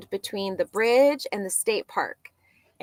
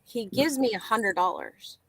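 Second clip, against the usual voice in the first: normal talking voice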